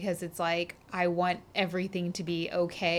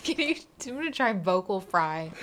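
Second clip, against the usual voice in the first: normal talking voice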